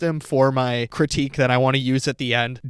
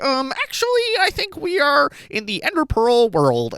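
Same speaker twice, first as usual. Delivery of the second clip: much higher, mocking meme-kid voice; TikTok cringe voice; 12 semitones higher than usual. Critic imitates the young actor, TikTok cringe voice. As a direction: parody an awkward know-it-all in meme speak. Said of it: "says stuff in the most TikTok cringe voice".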